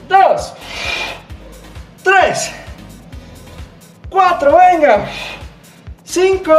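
high, punchy rep count; barking gym coach. Acted. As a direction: drive the class through every rep. Coach counting reps to music. (entrenador contando a gritos)

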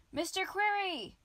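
high, loud calling out; shouting. A narrator's reading. Wizard calling for his glowworm, shouting. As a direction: call the name at full voice. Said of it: "Ombric shouted".

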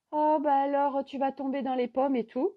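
imitating the dad teasing his hungry son; teasing father.

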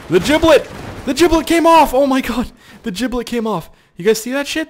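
high, loud shocked yelps; excited yelling. Real, not acted. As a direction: shriek in disbelief at the screen. Giblet pops off in game.

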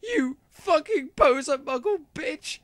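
very high, halting furious insult; angry shout. A narrator's reading. Draco accusing Ebony, shouting angrily. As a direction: hurl it in a high, outraged voice. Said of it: "he shouted".

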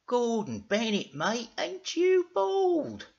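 high, slow mock amazement; cheeky, drawn-out character voice; Dougal. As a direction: stretch it out in high-pitched astonishment. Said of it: "said Dougal".

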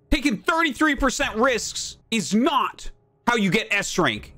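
normal talking voice

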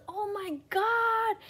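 high, loud, slow squeal of fake awe; mock the breathless reactors; drawn-out gasping oh my God; mocking gushing fan. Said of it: "All the answers".

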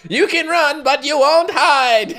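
mocking echo. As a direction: parrot her line back with a laugh. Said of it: "laughs, repeats the line".

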